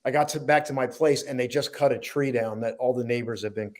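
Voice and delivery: usual speaking voice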